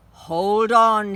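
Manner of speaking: loud lion roar of a command. Acted. Aslan roars to the girls, loud and commanding. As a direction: boom it out like a lion. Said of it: "he roared".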